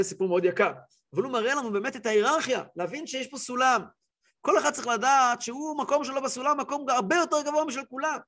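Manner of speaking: usual speaking voice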